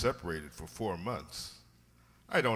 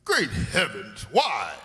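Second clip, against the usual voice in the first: louder, drawn-out startled outburst; shocked exclamation; 7 dB louder, slower than usual. Acted. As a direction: blurt it out in disbelief. Watson startled by the revelation.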